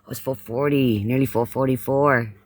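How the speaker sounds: normal talking voice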